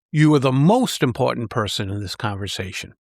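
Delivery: emphatic "most", unstressed words after it; stress on MOST. Shown as a demonstration. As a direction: hit "most" hard, then come straight back down. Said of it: "Each emphasis changes where your audience's attention goes".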